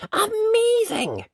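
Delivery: put-on amazed boy slowly squealing. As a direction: gasp it out in delight. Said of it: "said Jack".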